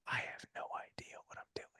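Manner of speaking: hushed, voiceless whisper; confessional whisper. Retold or imitated. Dad confessing a secret to his son, whispered.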